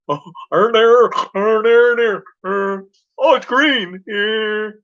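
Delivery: high, hesitant mock driver voice; mock dithering driver. Mocking the dithering driver at the green. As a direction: play the confused driver at the light, lightly.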